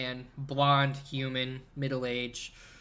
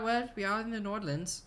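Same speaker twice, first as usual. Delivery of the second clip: higher, quick mock-Swedish lilt; Swedish-sounding accent; 7 semitones higher, faster than usual. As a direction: answer in a put-on Nordic accent. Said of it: "You sound weirdly Swedish".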